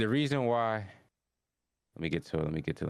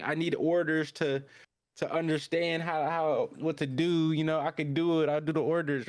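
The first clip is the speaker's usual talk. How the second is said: higher-pitched retold plea; imitated character line; 7 semitones higher than usual. Retelling the sketchy dude asking for orders, higher voice. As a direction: voice the character higher, talking it through.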